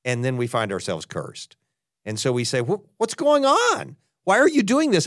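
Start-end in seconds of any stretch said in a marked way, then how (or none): 2.6-5.1 bewildered cry to God. Retold or imitated. Believers crying out to God.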